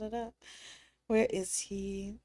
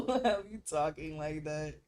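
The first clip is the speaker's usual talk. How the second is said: ordinary delivery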